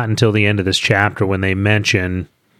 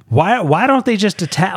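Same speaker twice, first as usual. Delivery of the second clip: exasperated; 8 semitones higher than usual. Retold or imitated. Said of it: "and I'm like".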